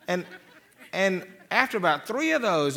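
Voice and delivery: usual speaking voice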